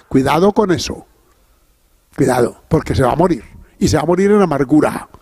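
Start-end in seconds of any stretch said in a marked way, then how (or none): none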